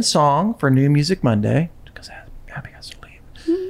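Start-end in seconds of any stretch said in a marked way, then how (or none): 1.9-3.7 whispering. Real, not acted. Quick aside during intro.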